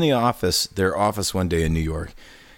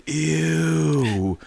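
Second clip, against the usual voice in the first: drawn-out, higher mock revulsion; exaggerated grossed-out reaction; disgust; 6 semitones higher than usual. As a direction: recoil with a long retold groan of distaste. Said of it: "And I was like".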